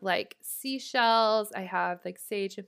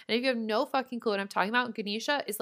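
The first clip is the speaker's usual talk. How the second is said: ordinary talk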